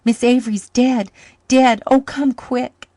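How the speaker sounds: put-on high, loud, frantic boy's cry; panicked child. Boy crying to his uncle that his friend is dead. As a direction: wail it in terror.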